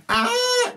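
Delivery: short high-pitched comic wail; mock scream. Storyteller acting out people running away, mock scream. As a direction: let out a high mock shriek.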